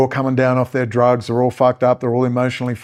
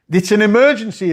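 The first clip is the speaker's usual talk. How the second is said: higher, louder insistent repeat; loud sharp voice; 8 semitones higher, 3 dB louder than usual. Shown as a demonstration. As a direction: drive it home, raising voice.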